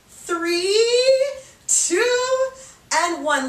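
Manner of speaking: high, loud drawn-out count; energetic coach's countdown; rallying countdown. Real, not acted. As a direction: stretch the numbers high to push them through. Counting down the hard last seconds.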